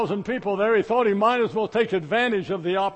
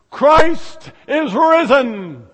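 slow, ringing proclamation, much louder; loud and clear; 5 semitones higher, 8 dB louder, much slower than usual. Acted. Priest to the hall, ringing and loud. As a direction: call it out to the whole hall. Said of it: "very loud and clear voice".

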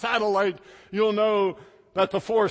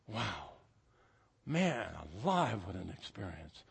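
hushed awe; 12 semitones lower, 12 dB quieter than usual. Real, not acted. Awed recollection of moon landing.

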